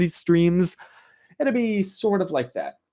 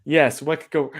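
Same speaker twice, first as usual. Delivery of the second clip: ordinary talk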